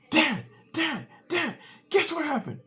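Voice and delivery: put-on high, eager boy calling his dad; excited kid. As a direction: burst in bursting with news. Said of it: "all excited and exuberant".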